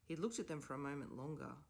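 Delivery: plain reading voice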